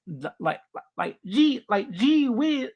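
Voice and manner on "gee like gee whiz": put-on high southern country accent; slow, high-pitched southern drawl; Stinky. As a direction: drawl it like a cartoon southern kid. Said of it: "that's his accent".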